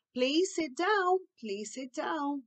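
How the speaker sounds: very slow, drawn-out coaxing; patient request. Shown as a demonstration. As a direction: ask a child slowly and patiently. Models positive replacement phrase. (pedido lento e paciente)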